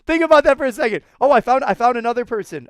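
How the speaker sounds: amused excitement. Real, not acted. Amused at lagging swimmers.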